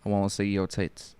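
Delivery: put-on quiet, cheeky line in a British accent. Troll character Brian, a quiet British-accented line. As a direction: play the crude British troll. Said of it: "you're so good with that fucking accent".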